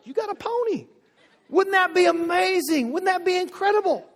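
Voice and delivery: high, loud, delighted encouragement; enthusiastic parent. Retold or imitated. Parent delighting in a child's pony dream, excited. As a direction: dream along with the kid. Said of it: "what if we did this".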